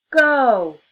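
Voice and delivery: shouting. A narrator's reading. Race start shout.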